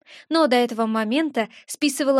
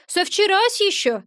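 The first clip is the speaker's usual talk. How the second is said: higher, cheeky little imp; a touch of a grumbling brat; cheeky grumble; 8 semitones higher than usual. Acted. Gremlin grumbles he has sat there since yesterday, cheeky. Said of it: "буркнул мне маленький нахалёнок".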